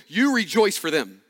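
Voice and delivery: ordinary talk, nothing marked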